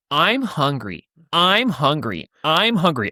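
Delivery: imitating a nagging kid, the same falling tune each time; annoying repeated pestering. Younger brother nags for forty-five minutes in the woods, annoying pestering. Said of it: "starting to get pretty annoying".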